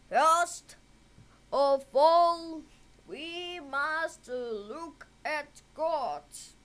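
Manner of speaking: the uncle taking charge; uncle's voice. A narrator's reading.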